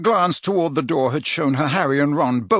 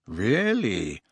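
understated, sceptical "Really?"; a drawn, unimpressed comeback; cool challenge; 3 semitones lower, 4 dB quieter than usual. A narrator's reading. Boy answering a threat, coolly defiant. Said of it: "said Harry".

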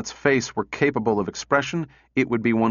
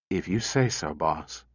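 quiet and hurting; pained; 6 semitones lower, 6 dB quieter than usual. Acted. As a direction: let the hurt show softly. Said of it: "There was pain in his voice".

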